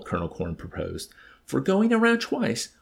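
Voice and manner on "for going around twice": very high, loud echo question; disbelieving question, leaping up in pitch; incredulous. A narrator's reading. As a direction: throw it back in disbelief. Incredulous colonel questions the medal.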